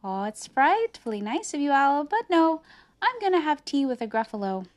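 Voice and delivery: high, quick little mouse voice; high-pitched, polite story mouse; breezy mouse. Acted. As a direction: chirp a polite refusal as the mouse.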